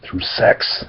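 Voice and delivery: a sly whisper; whispered punchline. Acted. Narrator teases the listeners, whispered joke. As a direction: drop it to a hushed aside.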